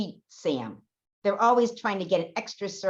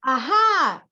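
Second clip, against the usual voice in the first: triumphant aha; 7 semitones higher, 7 dB louder than usual. Acted. Director catches applesauce thief.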